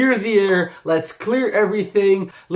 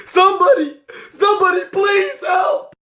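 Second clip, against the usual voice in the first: higher, fast, hammed-up panic rising to a yell; mock hysteria; 8 semitones higher than usual. Parodying overreacting viewers with hammed-up panic. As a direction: play the outraged hypocrite crying for help.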